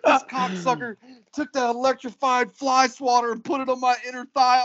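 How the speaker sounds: high-pitched retold outrage; indignant accusation. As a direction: tattle on him, hurrying toward the end. Tattling angrily on father-in-law.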